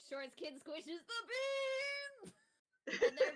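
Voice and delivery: high, slow, giggling delight; laughing excitedly. Real, not acted. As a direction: squeal it through laughter. Player delighted narrating her character.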